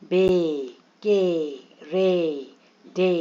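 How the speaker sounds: बे, के, रे, डे each held long, sliding down; long A sounds. Shown as a demonstration. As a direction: draw out the ए in बे, के, रे, डे. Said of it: "long ए sounds थे सारे".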